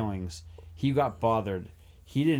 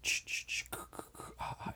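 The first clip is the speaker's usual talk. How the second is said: whispered horror-film ch-ch, k-k rhythm; hushed percussive hisses and clicks, mimicking a slasher score; imitated horror soundtrack; 13 dB quieter than usual. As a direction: imitate creepy soundtrack noises under your breath. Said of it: "with the soundtrack of".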